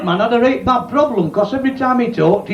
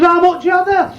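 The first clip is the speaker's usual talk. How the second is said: customer voice; 8 semitones higher than usual. Acted.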